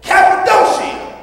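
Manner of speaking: high, punched place name; emphatic call-back. Real, not acted. Preacher echoing the reader's place names, emphatic call-back. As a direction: call it out to drive it home.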